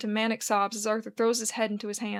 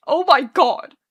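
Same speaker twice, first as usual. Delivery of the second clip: loud, higher-pitched sobbed cry; sobbing; 4 semitones higher, 10 dB louder, slower than usual. A narrator's reading. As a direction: sob it out in despair. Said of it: "he sobs".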